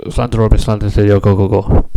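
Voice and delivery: loud, rattled-off Welsh syllables; spitty Welsh place name. Shown as a demonstration. Showing why people in Wales spit, loud Welsh pronunciation. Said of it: "'cause they do spit a lot".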